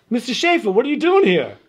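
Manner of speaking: high, loud imitation of a startled mother; surprised mom. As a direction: greet an unexpected visitor in mock astonishment. Retold mother surprised at the door.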